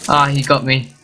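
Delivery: ordinary talk, nothing marked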